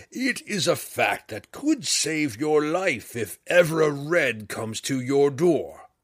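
slow, loud, booming voice of an ancient red dragon. A narrator's reading. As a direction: let the great dragon boom down at the small intruder. Dragon Hephaestus speaks.

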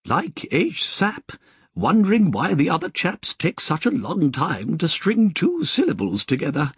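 high, refined English lady's voice; prim British nun, gently lilting; Sister Georgina's polite English accent. Acted. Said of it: "in her polite English accent".

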